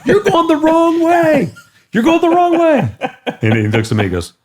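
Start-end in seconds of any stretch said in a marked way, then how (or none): 0.1-2.9 mock yelling. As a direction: imitate someone yelling at speeding cars. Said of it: "He's yelling at the cars".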